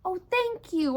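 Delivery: usual speaking voice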